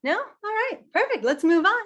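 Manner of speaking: high, quick brush-off; host skipping questions too fast, high and breezy; rushing host. Pretend. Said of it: "and I go".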